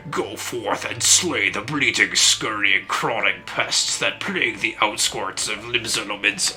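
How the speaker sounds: slow, gruff orc voice with a hint of menace. Streamer rereads the guildmaster as an orc, slow gruff orc voice. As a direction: growl it out like a Warcraft orc. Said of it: "my orc voice is not that good".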